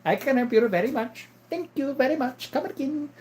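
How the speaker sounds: very high put-on Indian accent; high, mock Indian-accented thanks. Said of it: "an Indian guy".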